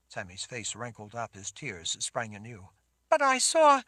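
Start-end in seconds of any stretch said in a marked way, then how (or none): 3.1-3.8 high, crying protest; tearful. A narrator's reading. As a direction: break off mid-sob. Girl crying, cut off.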